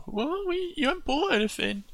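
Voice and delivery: put-on high, puzzled little-kid voice; high child's bewildered question; confused child. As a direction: ask it like a baffled kid.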